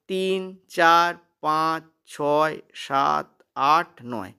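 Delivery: very slow, spaced-out count, pitch sinking. Shown as a demonstration. Teacher showing students how to count up to nine, slow counting. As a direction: count each number out slowly, stepping downward.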